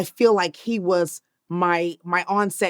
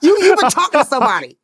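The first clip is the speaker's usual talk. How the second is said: louder, higher teasing demand; mock-accuse him of a leak; playful accusation; 6 semitones higher, 5 dB louder than usual. Real, not acted.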